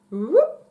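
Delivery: short, high, loud whoop; rising whoop. Retold or imitated. Storyteller mimics the moment with a sound, rising whoop. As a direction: let out a quick whoop that swoops upward.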